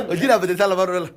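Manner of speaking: normal talking voice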